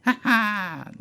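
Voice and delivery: high, loud ha-ha cackle; cackling laugh. Acted. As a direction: gloat with a sharp cackle. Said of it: "laughed the same woman".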